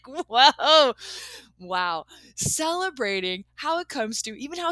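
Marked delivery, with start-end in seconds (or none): 0.1-2.1 amazed whoa. Pretend. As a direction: react in delighted disbelief. Celebrating a surprise gift.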